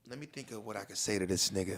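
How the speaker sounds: low, hushed aside; thinking to oneself. Acted. Texter stalling over what to write back, quiet inner monologue. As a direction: say it under your breath, as a private thought. Acting out a texter's private thought.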